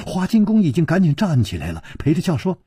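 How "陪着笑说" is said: ordinary delivery, nothing marked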